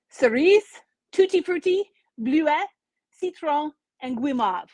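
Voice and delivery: flavour names read in a self-mocking French accent. Shown as a demonstration. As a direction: cerise and bleuet given a French ring. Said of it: "My apologies for my horrible accent".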